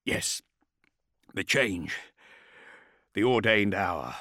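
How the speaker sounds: strained warrior, halting; pained soldier, phrase by phrase; gritted teeth. A narrator's reading. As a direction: force it out through gritted teeth. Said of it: "with gritted teeth".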